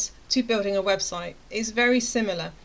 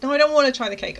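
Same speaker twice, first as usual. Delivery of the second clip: louder, higher stubborn protest; retold petulant outburst; upset refusal; 5 semitones higher, 7 dB louder, faster than usual.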